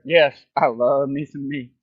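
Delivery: loud, slow, boastful quote; Terrell Owens impression. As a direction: brag it out big and slow. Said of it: "Quoting that man".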